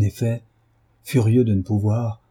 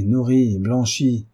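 ordinary delivery

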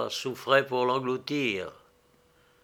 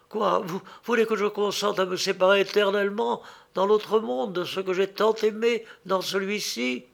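high, fast horrified question; horrified protest; 7 semitones higher than usual. A narrator's reading. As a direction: gasp it out in dismay. The girl protests in horror.

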